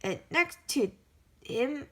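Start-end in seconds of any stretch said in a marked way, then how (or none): none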